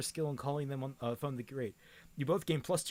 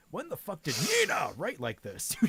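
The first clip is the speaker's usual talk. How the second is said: normal talking voice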